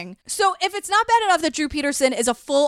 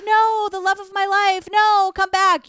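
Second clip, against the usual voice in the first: higher, louder hammed-up plea of an abandoned husband; mock pleading husband; 5 semitones higher, 4 dB louder than usual. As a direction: ham up a lovesick husband begging her back. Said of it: "Drew was like".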